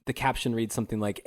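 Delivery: usual speaking voice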